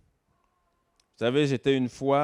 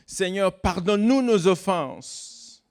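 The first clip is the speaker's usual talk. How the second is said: reciting the Lord's Prayer higher and slower; solemn high prayer recitation; 8 semitones higher, 3 dB louder, slower than usual. Retold or imitated. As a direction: intone it like a prayer. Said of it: "la fameuse prière quand on dit".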